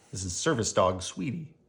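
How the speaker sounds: ordinary delivery, nothing marked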